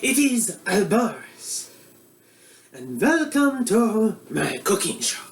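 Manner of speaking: put-on comic Eastern European accent as a bumbling TV cook; broad Russian accent; mock Russian accent. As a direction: play a hammy Russian-accented chef host. Comedic Boris cooking show character.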